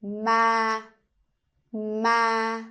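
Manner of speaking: level tone on ma; Vietnamese level tone. Shown as a demonstration. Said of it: "raise a pitch a little bit and then keep it stable".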